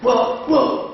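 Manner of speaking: high, thin woof from far away; imitated dog barking, pitched high and distant; raspy imitated bark. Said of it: "su voz rasposa".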